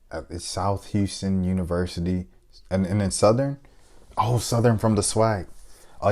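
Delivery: ordinary talk, nothing marked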